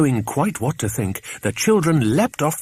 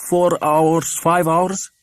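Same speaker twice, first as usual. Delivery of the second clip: Andros the boatman; 7 semitones higher, 4 dB louder, slower than usual. Acted. Andros answers, voiced higher.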